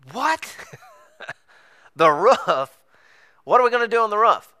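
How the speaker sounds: high-pitched startled disbelief; incredulous. Retold or imitated. Friend hearing the plan to get on the roof, incredulous. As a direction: balk at a crazy suggestion.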